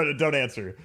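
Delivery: usual speaking voice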